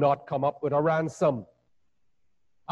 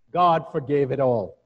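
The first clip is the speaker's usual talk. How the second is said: normal talking voice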